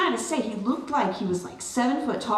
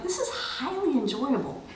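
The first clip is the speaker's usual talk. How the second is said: dry amused aside; 4 semitones higher than usual. Retold or imitated. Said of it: "And I was like".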